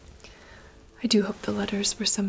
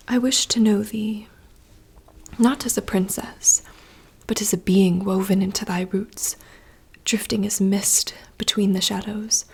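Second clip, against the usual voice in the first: louder, urgent stage whisper; intensifying whispered monologue; 7 dB louder than usual. Acted. Princess pleading with the dark wood, louder urgent whisper. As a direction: push the whisper toward the climax.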